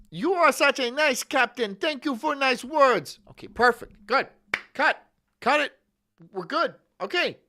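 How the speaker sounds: hammed-up director wrapping a take; mock film director. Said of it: "the fucking directors just be like".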